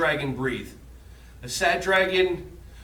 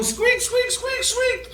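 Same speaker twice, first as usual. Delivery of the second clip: very high squeaks; playful high mouse squeaks; happy squeaks; slower than usual. Shown as a demonstration. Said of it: "let me hear some happy squeaks".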